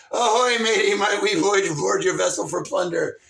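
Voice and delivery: loud, low pirate captain; big booming buccaneer voice; pirate hail. Acted. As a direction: bellow it across the water to another ship. Plays pirate hailing a ship.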